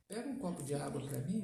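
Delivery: low, soft, easygoing ask; gentle request. Shown as a demonstration. Father asking his daughter for water, gentle request. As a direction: ask kindly, low and unhurried in volume. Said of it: "a entonação da voz já diz muita coisa".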